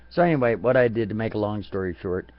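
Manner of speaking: ordinary talk, nothing marked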